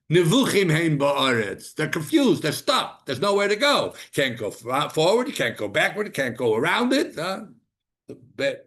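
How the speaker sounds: high, loud cries of being stuck; fervent preaching. Acted. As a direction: preach the trap with urgency. Preaching at full force.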